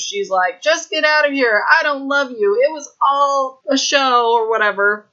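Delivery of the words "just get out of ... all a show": high, hammed-up tragic heroine; mock Moulin Rouge farewell, pitched up; dramatic Satine. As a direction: play the noble breakup like a movie diva. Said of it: "Satine style from Moulin Rouge".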